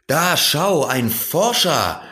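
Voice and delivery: lifted, drawn-out delighted call; an old scholar pleased to spot a visitor; old man calls out. A narrator's reading. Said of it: "rief er". (freudiger Ausruf eines alten Herrn)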